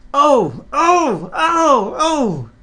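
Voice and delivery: very high, loud shrieks; screaming trucks. Acted. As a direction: squeal as the couplings jerk. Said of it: "they screamed".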